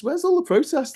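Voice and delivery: high-pitched, incredulous appeal; exasperated calling out. Acted. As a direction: call out as if searching for absent crowds. Calls out for missing protesters.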